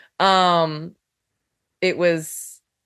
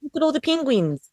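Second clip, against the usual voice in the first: quick, clipped New Zealand accent, pitched up; 6 semitones higher, faster than usual. Shown as a demonstration. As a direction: rattle it off like a Kiwi. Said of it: "do a New Zealand accent for me".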